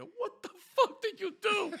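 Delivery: high, loud mock outrage; incredulous buddy. Buddy calling two minutes later, loud incredulity. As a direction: demand it at a near yell.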